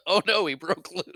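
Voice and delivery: laughing through a put-on cry of alarm; mock alarm. Playing along as the guard with a loose monster, mock alarm.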